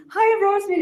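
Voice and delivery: high, loud sing-song hello; cheery greeter. Retold or imitated. Little man waving at Rosemary, cheery high hello.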